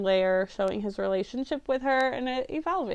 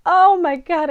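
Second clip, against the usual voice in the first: a much louder burst of disbelief; loud exclamation; 5 semitones higher, 10 dB louder than usual. Real, not acted. Reviewer reliving the book's ending to viewers, a loud stunned outburst. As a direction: blurt it out, overwhelmed. Reacting to character's death.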